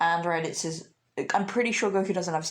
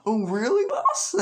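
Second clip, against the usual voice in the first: henchman voice, higher with a sharp squeak on boss; a shaky attempt at a lackey's voice; Nappa voice; 4 semitones higher, 4 dB louder than usual. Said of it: "I don't really know their voices".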